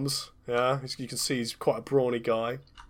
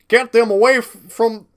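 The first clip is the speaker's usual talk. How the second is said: put-on Texan accent; 9 semitones higher, 9 dB louder than usual. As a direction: shout it like a Texan boss on the tannoy. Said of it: "I've gone Texan".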